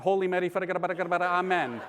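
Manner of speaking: gibberish rosary in a mock Tagalog accent. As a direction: rattle off the prayer in a heavy Tagalog accent. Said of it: "with a really strong Tagalog accent".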